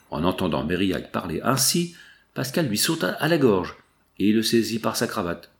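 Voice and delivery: usual speaking voice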